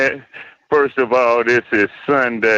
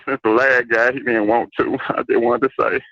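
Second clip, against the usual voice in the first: normal talking voice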